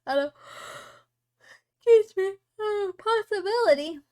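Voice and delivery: words stretched through a yawn. Real, not acted. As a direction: talk while yawning. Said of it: "I don't mean to yawn".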